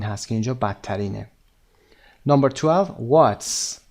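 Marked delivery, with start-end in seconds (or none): 3.1-3.8 very slow, carefully spaced words; slow model reading. Shown as a demonstration. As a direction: read it out slowly for learners. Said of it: "teacher reads item slowly".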